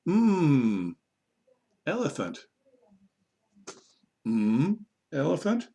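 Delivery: hummed "Mm", pause, then "elephant"; sound then word. Shown as a demonstration. Teacher testing first sounds, "Mm" held before "elephant". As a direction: set "Mm" apart from "elephant", ending questioningly. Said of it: "you're gonna go".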